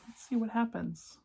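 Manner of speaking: ordinary delivery, nothing marked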